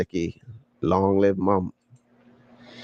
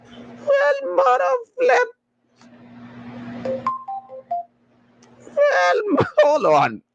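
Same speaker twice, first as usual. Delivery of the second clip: squeaky high laughing squeal; cracking up in a high squeal; wheezing laugh through the words; much slower than usual. Real, not acted. Laughing fit reading a comment.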